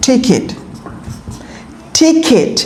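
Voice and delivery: ticket with a breathy th on t; aspirated t. Shown as a demonstration. Teacher modelling ticket for students. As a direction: puff air on the t of ticket. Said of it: "এটা কি হবে?".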